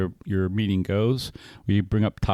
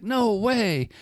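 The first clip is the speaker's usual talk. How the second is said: much higher mock shout at his friend; retold defiant outburst; 11 semitones higher than usual. Angry sponsee refusing the friend's advice, high mock shout. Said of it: "And I was like".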